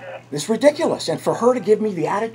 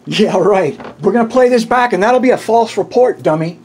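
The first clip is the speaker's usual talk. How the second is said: loud, raised-pitch scornful comeback; angry retort; 3 semitones higher, 5 dB louder than usual. Real, not acted. Man scoffs at a bystander claiming he was threatened, angry retort. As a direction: throw it back loudly at the accuser.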